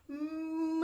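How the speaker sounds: sung-out gentle cow sound; cow moo. Acted. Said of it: "Can you moo like a cow?".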